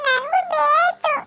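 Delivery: put-on high, slow tearful child's demand; crying demand. A child tells her mother she wants some too, wailing. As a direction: wail it like a child wanting it.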